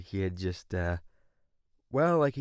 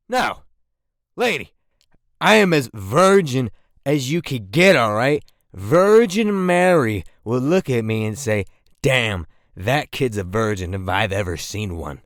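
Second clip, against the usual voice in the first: indignant loud protest; 3 semitones higher, 9 dB louder than usual. Retold or imitated. Kid protesting loudly to the lady doctor, indignant shout. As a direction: shout your innocence at the doctor.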